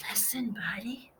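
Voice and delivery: soft breathy whisper. Acted. Parents whispering so Buddy will listen, whispered. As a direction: whisper it close and secret. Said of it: "They tried whispering".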